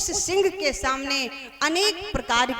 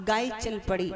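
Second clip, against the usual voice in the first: ordinary delivery, nothing marked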